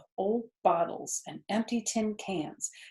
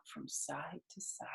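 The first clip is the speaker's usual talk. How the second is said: whisper; 4 semitones lower, 18 dB quieter than usual. A narrator's reading. Whispered end of narration.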